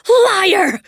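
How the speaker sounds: high, loud shriek of one word. Acted. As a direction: spit out the word liar. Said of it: "she shrieked".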